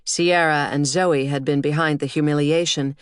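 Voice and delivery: plain reading voice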